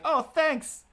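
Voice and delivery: put-on high, sugary little-kid voice; mock sweet voice. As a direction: play an overly grateful child. Mock sweet reply to old man.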